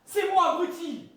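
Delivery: furious, slow, loud shout; angry shout. Acted. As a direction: yell it at the idiot, each word hammered. Furious at the blunder. (hurle, furieux, en martelant chaque mot)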